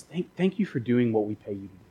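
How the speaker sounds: low, very soft, halting thanks; hushed, emotional boss, lightly played; acting choked-up gratitude under the breath; mock tearful. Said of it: "And he's, like, crying".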